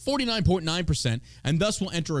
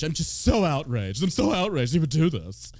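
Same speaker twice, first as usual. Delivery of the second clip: mock outrage. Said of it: "selective outrage".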